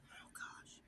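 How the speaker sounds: breathless whispered oh gosh under her breath; whispered aside. Real, not acted. Tripping over a name, whispering to herself. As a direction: mutter it to yourself after tripping up. Stumbling over Gileadite names.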